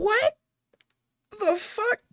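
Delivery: high, cracking mock fury; high outraged squeal. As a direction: shriek it as if insulted to the soul. Outraged retort retold.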